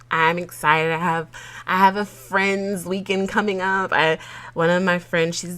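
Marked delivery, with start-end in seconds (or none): none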